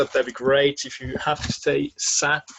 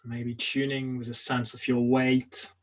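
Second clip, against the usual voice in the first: guided meditation; 4 dB quieter than usual. Acted. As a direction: guide them gently through a standing body scan, leaving long silences. Leads a standing meditation.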